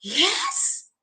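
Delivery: soft yes shooting up in surprise; hushed, rising surprise; surprised. Shown as a demonstration. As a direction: let the pitch leap as if astonished. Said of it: "What about surprise?".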